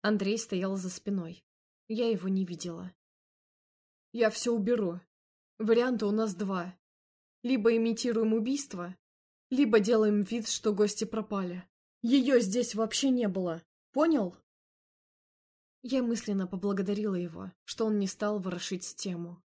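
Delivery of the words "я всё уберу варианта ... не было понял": louder, commanding male voice; firm man's voice. Acted. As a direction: take charge, louder and decisive. Andrey's voice, taking charge.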